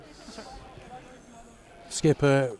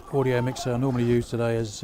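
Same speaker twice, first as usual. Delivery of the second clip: normal talking voice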